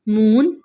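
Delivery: moon loud, oo drawn out and rising; moon, oo held for contrast; long oo in moon. Shown as a demonstration. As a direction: stretch the vowel of moon. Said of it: "ऊ का sound difference आ रहा है".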